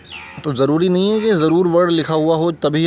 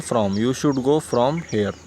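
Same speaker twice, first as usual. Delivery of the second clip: slow dictation; 2 semitones lower, much slower than usual. Shown as a demonstration. Teacher to students, dictating the sentence very slowly. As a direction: let each word sink in for the learners. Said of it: "हम यहाँ कहीं से जाने के लिए क्या कहते हैं".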